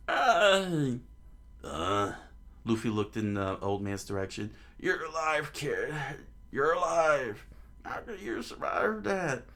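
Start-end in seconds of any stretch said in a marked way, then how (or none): 0.1-0.6 high, loud acted yelp; old man's scream. Old man screams at the crashed boy, high yelp. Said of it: "a loud scream from an old man". 4.8-9.4 put-on high-pitched, incredulous old-timer; astonished old man. Old man to boy after crash, astonished.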